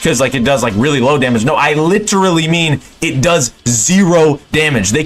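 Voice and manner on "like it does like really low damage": ordinary delivery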